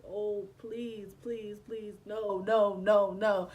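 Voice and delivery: pleas building to loud, repeated refusals; beg, then push each word out hard; retold desperate pleading. Acting out desperate pleading in her mind.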